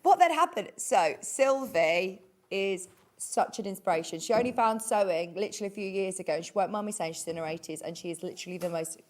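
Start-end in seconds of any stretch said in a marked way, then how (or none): none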